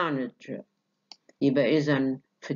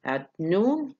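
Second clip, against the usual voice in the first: noon stressed and raised; tonic on noon; 5 semitones higher than usual. Shown as a demonstration. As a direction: lean on noon. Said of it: "Noon is the tonic syllable". (التركيز على noon)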